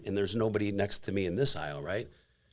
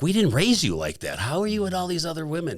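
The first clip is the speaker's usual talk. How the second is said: high, exasperated mom imitation; mock motherly telling-off; scolding mother; 8 semitones higher than usual. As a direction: retell a mother's reproach, pitched up. Imitating his mother scolding him.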